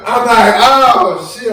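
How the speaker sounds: loud, laughing exclamation; laughing retelling. Retold or imitated. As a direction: laugh while telling it. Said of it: "start crying uncontrollably".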